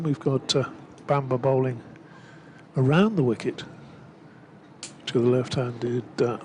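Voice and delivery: ordinary talk, nothing marked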